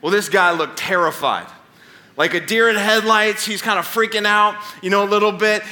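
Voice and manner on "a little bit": usual speaking voice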